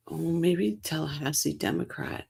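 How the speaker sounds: soft whispered aside; whispering. Real, not acted. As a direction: whisper it to yourself. Muttering an idea to herself.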